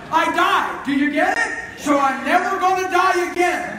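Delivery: loud, high preaching shout; full-force preaching. Real, not acted. Preacher shouting to the congregation that he'll never die, full force. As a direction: preach it out with rising fire.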